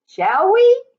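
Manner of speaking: usual speaking voice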